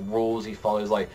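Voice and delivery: ordinary talk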